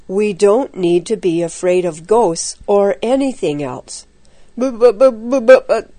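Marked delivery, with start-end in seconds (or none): 4.6-5.9 higher, frightened stutter; jittery, scared repetition; teeth-chattering stammer. A narrator's reading. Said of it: "his teeth chattering".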